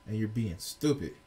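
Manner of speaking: normal talking voice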